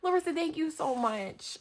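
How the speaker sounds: high, tearful thanks; fighting back tears. Real, not acted. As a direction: thank her while holding back crying. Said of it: "Okay, don't cry".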